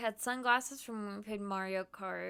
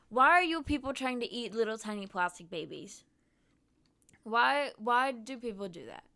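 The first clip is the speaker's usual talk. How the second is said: exasperated; 9 dB louder than usual. Real, not acted. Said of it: "exasperated rant".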